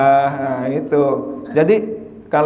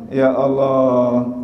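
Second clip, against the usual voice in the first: 1 exasperated sigh; much slower than usual. Real, not acted.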